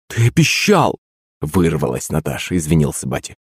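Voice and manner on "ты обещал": high-pitched, scandalised reproach; reproachful exclamation. A narrator's reading. Mother reminds father of his promise, reproachful. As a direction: protest the broken promise.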